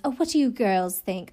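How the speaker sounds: put-on Lillian in a British accent; a quick British accent for the head nanny. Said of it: "I guess I am gonna do the accent".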